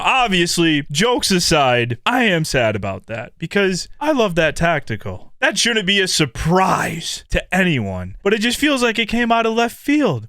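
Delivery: ordinary talk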